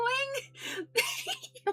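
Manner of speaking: real squealing laugh; helpless high laugh. Real, not acted. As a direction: shriek with laughter. Cracking up at the clip.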